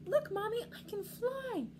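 put-on high, thrilled child voice, stretched at the end; Pip-Pip. Little penguin boasts to mommy of flying, gleeful. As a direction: call out to mommy in triumph. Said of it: "cried little Pip-Pip".

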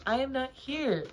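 high, loud protest from inside; storybook Toad. A narrator's reading. Grumpy hidden Toad answers Frog.

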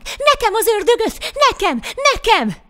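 very high, loud greedy shouts; children clamouring. A narrator's reading. Many hungry children each claiming the devil, clamouring shouts. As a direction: yell over each other like squabbling kids. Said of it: "Mind azt kiabálták".

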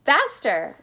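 forceful exclamation; urgent cry. A narrator's reading. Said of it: "cried Mister Wonka".

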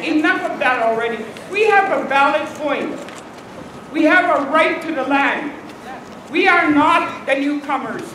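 loud, forceful rally declaration; emphatic protest. Real, not acted. Leader answering those who call her people yellers, emphatic protest. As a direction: hammer each statement out to the crowd. Rally speech reaches its peak.

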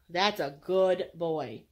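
slow, low praise to a dog; unhurried, reassuring pet talk; soothing praise. Real, not acted. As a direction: draw out each word, calm and approving.

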